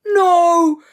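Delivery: hammed-up scream of no; mock anguish. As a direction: cry out in despair. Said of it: "and he's like".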